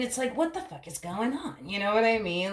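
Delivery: ordinary talk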